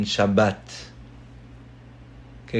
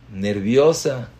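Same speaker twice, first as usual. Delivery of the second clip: ordinary talk, nothing marked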